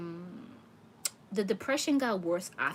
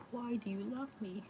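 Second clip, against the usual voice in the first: Why raised higher and spoken softer; higher, softer plea; 4 semitones higher, 5 dB quieter than usual. Retold or imitated. Reader voices the spirit asking his wife, higher soft plea. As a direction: lift it up and pull back the volume, pleading. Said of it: "That's what he's saying".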